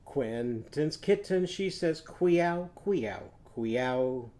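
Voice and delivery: queow sung slowly again; qu sound on meow. Shown as a demonstration. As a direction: sing queow cleanly. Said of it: "Let's try that again".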